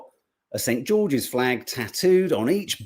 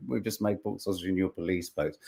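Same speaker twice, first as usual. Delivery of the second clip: lower, softer echo of Greg's line; quoting Greg; 7 semitones lower, 8 dB quieter than usual. Retold or imitated. Host fondly quoting Greg Stoker's joke, lower and softer. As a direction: savour his punchline quietly. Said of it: "I love him".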